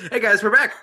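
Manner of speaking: loud, high fresh welcome; bright re-intro. Acted. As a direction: restart the show greeting. Connection restart, re-intro.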